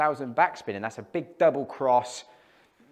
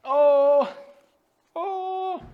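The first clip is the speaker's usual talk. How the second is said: high, sharp exclamation at the shot; surprised cry; 7 dB louder than usual. Real, not acted. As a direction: yelp in dismay as the ball flies. Reacting to a wayward shot.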